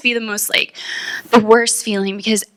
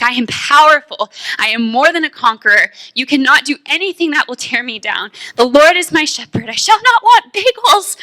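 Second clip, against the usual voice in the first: loud, rising, much higher at the end; bold proclamation; 5 semitones higher, 4 dB louder than usual. Retold or imitated. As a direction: preach it like you've already won. Proclaiming affirmations to Satan.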